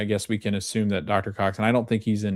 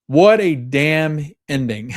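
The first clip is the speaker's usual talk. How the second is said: slow, loud, higher exclamation; real, raised-voice admiration; awed excitement; 6 semitones higher, 7 dB louder, slower than usual. Real, not acted. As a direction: marvel at it, stretching each word. Marvels at the episode's ending.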